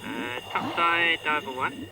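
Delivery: muffled through dive mask; diver mask radio. Real, not acted. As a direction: report from underwater over comms. Diver reporting over comms.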